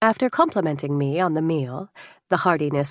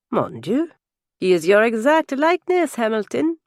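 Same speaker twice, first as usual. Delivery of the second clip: put-on higher, amazed French-accented exclamation; delighted French guest; 6 semitones higher, 4 dB louder than usual. French guest sees Hamilton's son, delighted and higher. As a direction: marvel aloud with a French accent.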